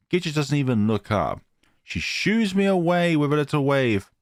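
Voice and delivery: plain reading voice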